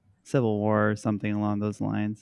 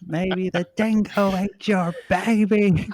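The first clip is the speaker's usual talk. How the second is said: put-on Australian accent; 8 semitones higher, slower than usual. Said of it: "[name] do an Australian accent".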